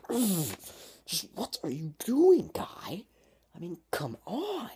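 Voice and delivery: high, loud, drawn-out exasperation; exasperated. Acted. Venting at friends who act dumb, exasperated. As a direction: groan it out in hammed-up disbelief.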